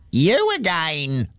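hammed-up grumpy shout; slow high mock shout at the neighbours. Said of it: "shouted Mister Ratchet".

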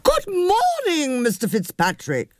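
high, slow trumpeting greeting; trumpeting call. Acted. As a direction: sing it out upward. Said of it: "voice trumpeted upwards".

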